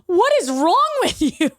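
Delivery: high-pitched incredulous protest; outraged disbelief. Retold or imitated. Retelling the reaction to his prank, high outraged disbelief. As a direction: exclaim it, aghast at being pranked.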